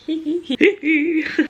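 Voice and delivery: loud, high-pitched tittering; high naughty giggle. Real, not acted. Giggles at her own naughty joke.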